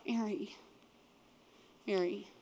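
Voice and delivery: soft and low, gently spoken name; tender call. Retold or imitated. Jesus gently calling Mary by name, soft and tender. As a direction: speak her name softly, like a quiet embrace.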